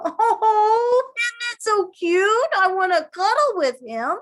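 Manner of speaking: high, loud cooing gush; swooning high-pitched delight; gushing over a cute photo. Pretend. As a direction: coo like you want to cuddle it. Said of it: "makes you go".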